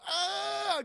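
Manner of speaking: acted "ah" of recoil; crew's pained cry. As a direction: cry out as if deafened. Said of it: "'cause it's so loud".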